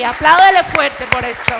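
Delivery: loud preacher's call for applause; rousing call. Real, not acted. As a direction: fire up the congregation. Predicadora pide aplauso al público. (llamado fuerte a aplaudir)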